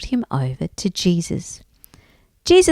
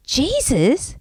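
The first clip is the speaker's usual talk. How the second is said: higher-pitched excited question; 5 semitones higher than usual. A narrator's reading. Bart hears who is passing, excited. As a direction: ask with dawning excitement. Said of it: "said Bart excitedly".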